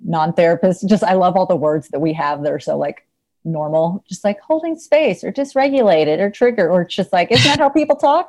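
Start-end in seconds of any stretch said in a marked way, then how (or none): 4.4-6.7 higher-pitched mock therapist-speak; mocking jargon. 7.3-8.3 high-pitched feigned puzzlement; mock innocence. Mock-innocent acted question.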